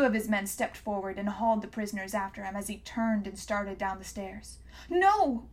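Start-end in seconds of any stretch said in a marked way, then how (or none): 4.9-5.5 high, loud wail; girl wailing as she's dragged away. A narrator's reading. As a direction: cry out as they're torn apart. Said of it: "wailed Catherine".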